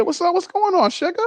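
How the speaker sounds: high, sugary mock-mom voice; doting mom.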